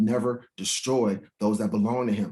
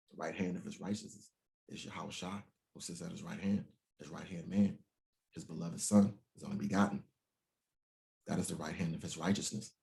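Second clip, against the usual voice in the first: quiet aside; 6 semitones lower, 12 dB quieter than usual. Real, not acted. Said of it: "quiet explanation".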